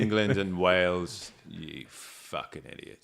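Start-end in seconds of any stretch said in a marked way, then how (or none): 1.5-3.0 muttered insult. Real, not acted.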